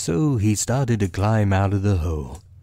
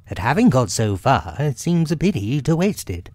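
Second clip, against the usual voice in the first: higher, reasoning character voice; lifted storybook animal explaining; Rabbit; 7 semitones higher, faster than usual. A narrator's reading. Rabbit justifying himself to Pooh, higher voice.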